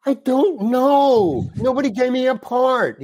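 high, slow exasperated whine; frustrated. Retold or imitated. Actor to the director, high exasperated complaint. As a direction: let the frustration burst out.

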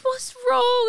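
high mock-concerned voice; imitated concerned midwife. Midwife to crying new mother, high imitated concern.

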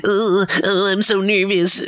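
hammed-up nervous camper; nervous kid. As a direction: squeak it out like a scared kid. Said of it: "Ben is like, they're like".

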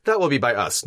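normal talking voice

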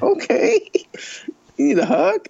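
high mocking offer of comfort; teasing. Real, not acted. Rob teases his emotional cohost, high and mocking. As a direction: needle your friend playfully. Teasing friend over tears.

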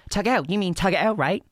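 put-on high mimic, querying; mimicking Heather. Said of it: "and was saying it like".

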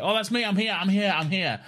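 high, eager mock Rose. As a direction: chirp it like she's desperate to be included. Voicing Rose eagerly joining call.

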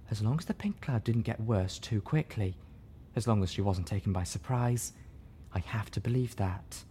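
soft, quiet and sad; tearful hope. Acted. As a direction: hold back the tears softly. Velvet hoping through tears.

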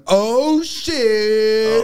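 high, long-drawn delighted exclamation; hyped greeting cry. Retold or imitated. Friend spotting Peter walk in, drawn-out hyped cry. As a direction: stretch it out like a hype man. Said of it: "cannot see Peter without going".

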